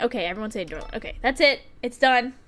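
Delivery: normal talking voice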